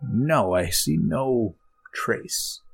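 slow, drawn-out alien voice; alien responding. Acted. Alien answers a faint distant voice, slow and drawn-out. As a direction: stretch the words out, otherworldly. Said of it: "and then he responds".

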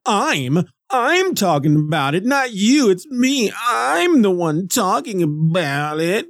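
high, slow mocking voice; mocking himself. Podcaster mocking his own clumsy phrasing, slow and high. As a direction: parrot his own flubbed line back at himself.

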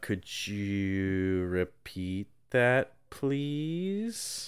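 slow, woozy mock daze, voice gone strange; dazed drugged guy. Drugged guy asks to repeat, slow woozy mock daze. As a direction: drawl it out like you're already half drugged.

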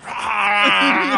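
loud, high mock scream; imitated metal scream. Mocking a singer screaming over rap beats, imitated metal scream. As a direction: burst out with a screamo "rah".

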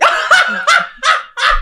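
very high burst of laughter; high, helpless-sounding laugh. Real, not acted. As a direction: crack up at your own argument. Cracks up at own argument.

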